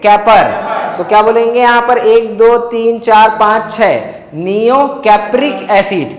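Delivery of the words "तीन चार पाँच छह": ordinary talk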